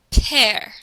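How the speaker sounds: "Père" with the voice dropping down; falling tone. Shown as a demonstration. Teacher showing the class the accent grave, falling tone. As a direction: let the pitch of "Père" fall as it is held. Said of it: "You hear how the tone of your voice goes down".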